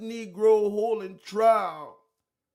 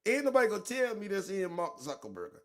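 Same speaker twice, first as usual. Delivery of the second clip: ordinary delivery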